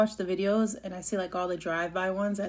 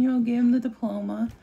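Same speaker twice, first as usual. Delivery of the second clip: ordinary talk, nothing marked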